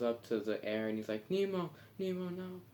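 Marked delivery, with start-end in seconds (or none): 1.3-2.6 high desperate calling of Nemo. Retold or imitated. Marlin at the surface calling for Nemo, high desperate call. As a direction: call out for him, pitched high. Father calls for lost son.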